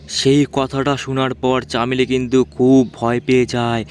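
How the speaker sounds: usual speaking voice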